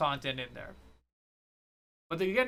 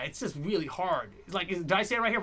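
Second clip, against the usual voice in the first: ordinary delivery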